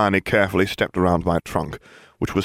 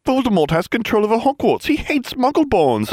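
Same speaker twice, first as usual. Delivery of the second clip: high, agitated outburst; snappish alarm; 3 dB louder than usual. A narrator's reading. Friend panics over the school takeover, snappish and alarmed. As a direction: spell out the disaster, high and frantic. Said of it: "she snapped".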